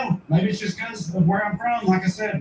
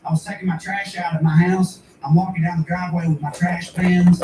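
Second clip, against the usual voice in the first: normal talking voice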